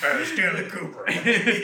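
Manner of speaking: a hint of a gruff general; army general voice. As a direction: bark his name like a general. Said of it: "Army general voice calling Stanley Kubrick".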